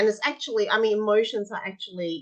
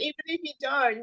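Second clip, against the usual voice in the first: high child voice, half-acted; a hint of a whining kid; voicing a child; 8 semitones higher than usual. Child voiced in retold talk.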